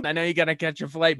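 ordinary delivery

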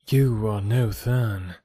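gentle princess voice, drawn out slowly; sweet voice. A narrator's reading. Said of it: "said the sweet voice of my companion".

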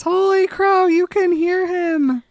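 high, loud, drawn-out mock scream; excited mock scream. Bella learning Edward can hear the baby, mock scream. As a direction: squeal it out in imitated amazement. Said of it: "She screams".

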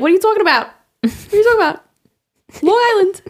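high, put-on Long Island voice; Long Island accent. Host doing the twang on purpose for laughs, high Long Island accent. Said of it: "I just, I do it on purpose".

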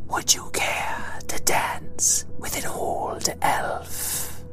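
put-on slow whispered old elf voice; wispy elf voice. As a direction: breathe it out softly, like mist. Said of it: "in her wispy voice".

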